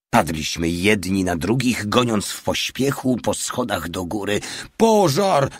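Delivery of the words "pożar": high, loud alarm cry; Mały yells to everyone the vault is burning, full shout; yelling. A narrator's reading. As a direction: scream out the fire alarm. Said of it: "darł się mały".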